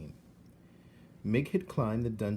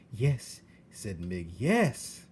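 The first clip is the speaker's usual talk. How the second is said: eager yes; 5 dB louder, much slower than usual. Acted.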